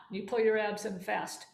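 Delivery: ordinary delivery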